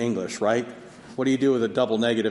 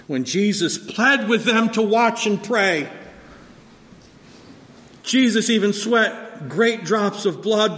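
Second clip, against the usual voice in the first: higher and louder, building intensity; preaching at full force; 9 semitones higher, 6 dB louder than usual. Acted. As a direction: drive it home from the pulpit.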